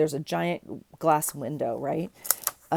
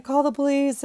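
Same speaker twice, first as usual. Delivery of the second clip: urgent call; 10 semitones higher, 5 dB louder than usual. Retold or imitated. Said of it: "And they're like".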